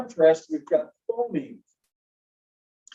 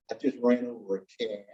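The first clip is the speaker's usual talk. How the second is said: put-on a light Southern American accent; 5 semitones lower, 6 dB quieter than usual. Teacher imitating a Southerner for the class. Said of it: "talked like a southerner".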